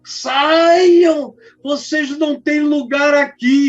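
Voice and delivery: loud, high-pitched holler; imitated angry shouting. American missionary ordering the young men out, loud high shout. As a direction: yell it like ordering intruders off. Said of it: "veio de longe gritando".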